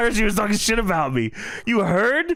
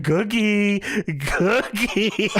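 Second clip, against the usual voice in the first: mock calling out; 4 semitones higher, much slower than usual. Retold search, calling for Cookie.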